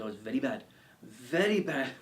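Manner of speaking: hammed-up tour guide accent, cracking up; Welsh-Hawaiian-Indian accent with a laugh breaking in; badly faked accent, emphatic warning; mock mixed accent. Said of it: "uh, accent".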